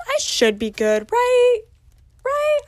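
jumping high on "right"; mock worry. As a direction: squeak "right" as a nervous question. Mock worry, seeking reassurance.

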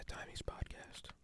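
hushed ASMR murmur; soft breathy ASMR whisper close to the mic. Shown as a demonstration. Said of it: "just A S M R".

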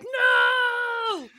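high shouted no; group no. Retold or imitated. Podcasters reacting to Josh's line, shouting a high "No!". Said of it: "And then we're all like".

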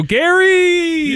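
high, stretched holler; playful long high yell; drawn-out name call. Pretend. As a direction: crow the name and hold the last vowel. Hosts repeat drawn-out name call.

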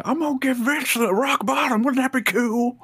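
high, goofy dumb voice; goofy impression. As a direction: play it dopey and excited. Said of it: "he's like".

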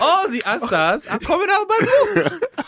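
high-pitched imitation, cracking up with laughter; mock lecturer's pitch. Student mimics lecturer plugging his own book, mocking and laughing. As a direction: laugh through a hammed-up sales pitch.

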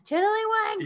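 "Tiddlywinks" called out high and sharp; yelled at the kids. Retold or imitated. Parents yelling the kids' safe word at them, "Tiddlywinks" thrown up high. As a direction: call "Tiddlywinks" out as a mock yell. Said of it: "sometimes we yell at them".

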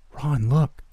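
put-on high-pitched child voice; hushed but insistent, pitched well up; urgent little brother. A narrator's reading. Little brother showing Ron what he found, urgent.

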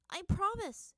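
high, loud hurried promise; girl quickly promising her father. A narrator's reading. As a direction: blurt it out fast. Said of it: "she said quickly".

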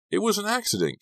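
very high-pitched, pleading protest; insistent. A narrator's reading. Kerry protesting to hostile teammates, insistent. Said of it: "Kerry insisted".

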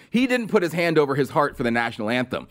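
ordinary delivery

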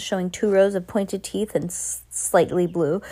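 usual speaking voice

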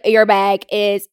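slow, measured robotic delivery. Retold or imitated. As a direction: spell it out like a robot, loud and slow. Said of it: "I'm speaking like a robot".

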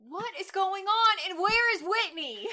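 high, put-on squeal of disbelief; shrill-free mock whine of outrage. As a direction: ham up the indignation.

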